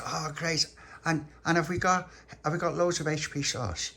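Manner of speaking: put-on high, keen little-boy voice; eager little boy. Little pappy asking mum about tea, eager little boy.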